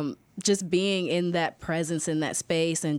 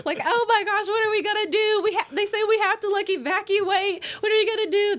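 retold panic; 6 dB louder than usual. Students running down the hall spread news, frantic high panic. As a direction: play the students' panicked alarm.

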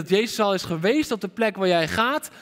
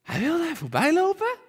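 high-pitched, baffled echo of the verse; stunned, much higher rising question; incredulous question; 12 semitones higher than usual. Acted. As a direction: question it in disbelief, voice shooting up at the end. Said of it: "Wat is dit?".

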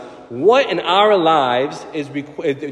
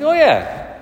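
much higher, fast and bright with conviction; exuberant retort; 8 semitones higher than usual. Retold or imitated. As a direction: brush off the worry with cheerful fire. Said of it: "I was like".

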